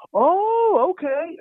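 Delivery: high, drawn-out placating reply; humouring voice. Acted. Store staff humouring Rusty, high and over-friendly.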